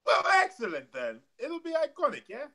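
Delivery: badly faked high Australian accent; hammy mock-Australian voice; horrible Australian accent. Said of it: "my horrible Australian accent".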